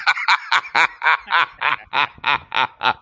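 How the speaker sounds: chuckling at his own punchline; low laugh. Real, not acted.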